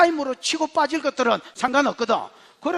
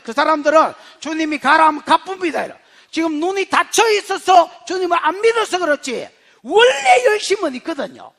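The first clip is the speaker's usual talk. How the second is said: loud, raised, impassioned preaching; full-force preaching; 6 semitones higher, 9 dB louder than usual. Real, not acted. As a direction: build to a forceful, high-pitched proclamation. (목소리 높여 힘차게 설교)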